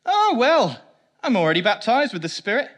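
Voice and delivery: high, loud, breezy dismissal; a light mock of complacent confidence; smug believer. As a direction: wave it off like it's settled. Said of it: "I can't do a Welsh accent".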